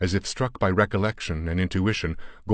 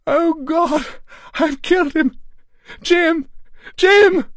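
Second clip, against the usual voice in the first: much higher, much louder anguished wail; wife screaming over her shot husband; scream of agony; 9 dB louder, slower than usual. A narrator's reading. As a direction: shriek his name in horror. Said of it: "her wild scream of agony".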